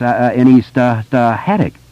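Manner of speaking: heavy stammer, pushing through; stuttering boy. A narrator's reading. Bill stutters his answer.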